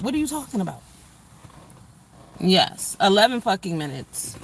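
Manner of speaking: usual speaking voice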